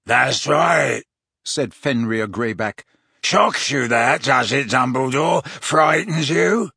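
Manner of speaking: loud, barking rasp; rasping werewolf taunt. Acted. Werewolf taunting Dumbledore, loud and rasping. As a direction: bark the taunt at him.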